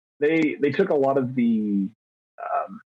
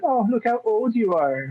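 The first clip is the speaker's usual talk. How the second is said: much higher mocking tease; teasing Shelby; 9 semitones higher than usual. Shelby teasing him about turning thirty, mocking and much higher. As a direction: rib him about his age, in a higher voice. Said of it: "It's like".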